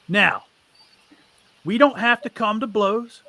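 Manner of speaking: a bandit boss bargaining with the party; bandit leader, wary. Acted. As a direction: warn them off without a fight.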